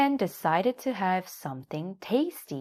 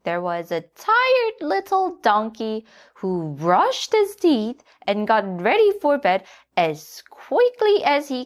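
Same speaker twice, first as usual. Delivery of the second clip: high hen voice telling a bedtime tale; Nanny Hen storytelling; 7 semitones higher, 7 dB louder than usual. A narrator's reading. Hen tells the donkey a bedtime story, high and louder. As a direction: lull him with a sleepy story.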